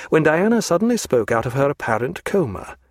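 ordinary delivery

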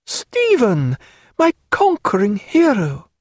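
high, effusive woman's greeting; delighted cry. Acted. Diana greeting Stephen as she enters, crying out delightedly. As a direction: cry out a welcome to your hero. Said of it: "cried Diana".

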